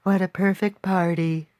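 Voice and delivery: lightly voiced sweet princess; a hint of cartoon princess; Princess Peach voice. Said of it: "my Princess Peach voice".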